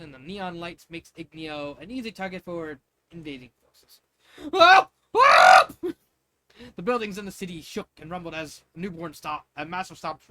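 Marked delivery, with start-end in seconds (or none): 4.5-5.7 two piercing shrieks of panic, very loud; terrified scream. Acted. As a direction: let out two full-throated screams of terror. Screams as the monster attacks.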